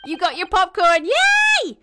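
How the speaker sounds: loud, high squeal of glee; cheer, rising to a high yay; high-pitched celebratory yell; excited cheer. Real, not acted. Cheers for a viewer.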